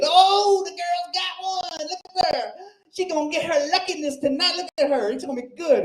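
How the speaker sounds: mother teasing her tired daughter, high and hammy; cackling old biddy. Retold or imitated. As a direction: ham up a cackling barfly aunt.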